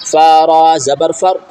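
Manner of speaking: usual speaking voice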